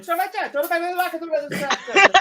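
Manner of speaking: high, drawn-out mock Italian rant; mock Italian shouting. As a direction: imitate an Italian hollering to the back room. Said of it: "shouting at the people in the back room in Italian".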